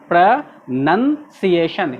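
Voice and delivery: "प्रोनंसिएशन" slowly, syllable by syllable, with "nun"; correct pronunciation demo. Shown as a demonstration. Teacher modelling the word for class, slow "प्रोनंसिएशन" with "nun". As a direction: break "प्रोनंसिएशन" into pruh-nun-see-ation, drawn out. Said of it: "इसको बिल्कुल अलग तरह से पढ़ेंगे".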